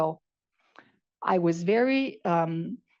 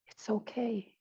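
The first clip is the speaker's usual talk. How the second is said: soft acceptance; 2 semitones higher, 9 dB quieter than usual. Retold or imitated. Quiet inner acceptance.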